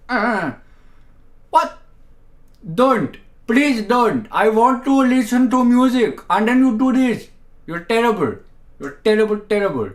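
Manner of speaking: high, loud, indignant pleading; mock outrage. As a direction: scold the stream for ruining the music. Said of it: "And it's like".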